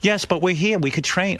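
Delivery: a hint of a fussing old mom; mother grumbling about the trainer, mildly mimicked; imitating his mother. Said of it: "My mother goes".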